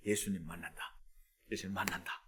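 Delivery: very low, soft self-talk; barely voiced, low and intent; hushed inner realization. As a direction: murmur it to yourself like a secret hope. Blind man's secret realization.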